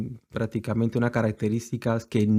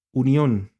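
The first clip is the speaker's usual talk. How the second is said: ordinary talk, nothing marked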